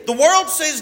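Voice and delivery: normal talking voice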